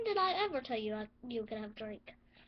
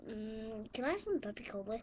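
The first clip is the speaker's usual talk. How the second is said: ordinary talk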